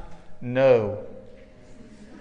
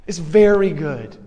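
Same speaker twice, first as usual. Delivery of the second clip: louder, ringing restatement of the quote; emphatic declaration; 5 dB louder than usual. Retold or imitated. Preacher restates God's verdict to the congregation, emphatic. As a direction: land it firmly with a strong VERY GOOD.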